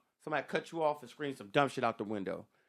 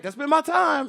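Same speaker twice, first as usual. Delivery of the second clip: hammed-up comic signoff; 12 semitones higher, 10 dB louder, slower than usual. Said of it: "He's like".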